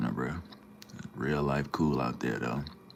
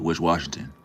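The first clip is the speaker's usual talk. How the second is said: ordinary talk, nothing marked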